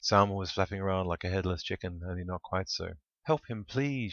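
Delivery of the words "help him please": a high frightened cry for help; shrieking. A narrator's reading. Hen begging the friends to help, shrieking. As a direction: plead in a shrill panic. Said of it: "she shrieked".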